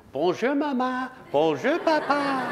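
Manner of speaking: very high, tiny newborn penguin voice, slowing at the end; a just-hatched chick greeting its parents, squeaky and high; baby penguin voice. Acted. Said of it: "the little baby penguin looks up and says".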